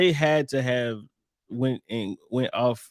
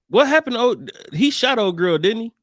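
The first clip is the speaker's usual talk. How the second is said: excited; 8 semitones higher, 5 dB louder than usual. Real, not acted. Sudden recall, louder.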